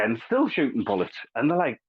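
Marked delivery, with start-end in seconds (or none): none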